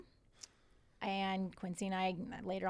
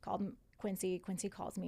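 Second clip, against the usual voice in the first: normal talking voice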